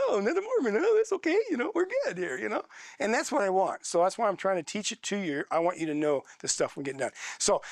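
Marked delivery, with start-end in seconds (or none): 0.1-2.4 breezy imagined reply. Pretend. Imagined confident student.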